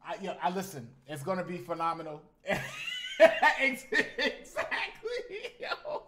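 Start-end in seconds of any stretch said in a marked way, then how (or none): 2.4-6.0 high squealing laugh through the words; laughing hard. Real, not acted. Streamer cracking up at chat's joke, high laughing. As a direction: let the laugh take over and stretch it out.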